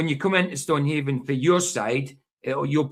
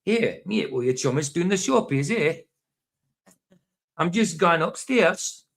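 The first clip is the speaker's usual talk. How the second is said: higher, rising tune as if everything's a question; questioning Edinburgh lilt; 4 semitones higher than usual. Acted. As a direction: imitate Edinburgh talk, lilting up into questions. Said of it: "sound like they're asking a question".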